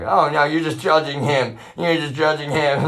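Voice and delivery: high, loud imitation of indignant defenders; mock protest. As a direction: protest shrilly on their behalf, mocking them.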